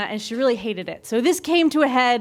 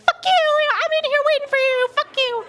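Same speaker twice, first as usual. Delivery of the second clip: very high, squeezed mad-monkey voice; high-pitched creature rant, comically furious. Retold or imitated. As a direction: voice an enraged little monkey taunting back. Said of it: "She's really mad".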